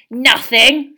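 contemptuous rising snarl; spat, scornful. A narrator's reading. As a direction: spit the word back as a question. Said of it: "spat Filch".

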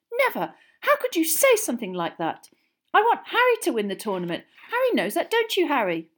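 high, loud outraged protest. A narrator's reading. Girl protesting her loyalty to her friend, outraged. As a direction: protest hotly, pitched right up. Hermione outraged, argues with Ron.

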